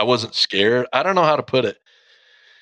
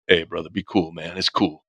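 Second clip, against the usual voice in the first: lower, softer soothing words; calm him like a scared brother; calming friend; 5 semitones lower, 4 dB quieter than usual. Retold or imitated. Best friend calming the dying man on the way, low soothing reassurance. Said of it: "he's like".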